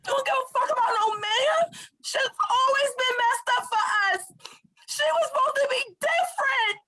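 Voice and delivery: real tearful shouting; crying outcry. Real, not acted. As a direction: rage and grief together.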